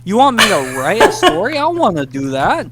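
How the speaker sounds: high, outraged whine; indignant rant. Retold or imitated. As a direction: complain like you've been cheated. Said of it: "I was pissed".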